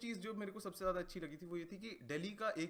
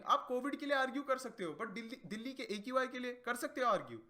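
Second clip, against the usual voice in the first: ordinary talk, nothing marked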